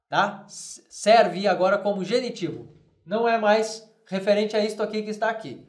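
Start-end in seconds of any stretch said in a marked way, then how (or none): none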